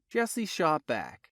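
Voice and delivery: ordinary delivery, nothing marked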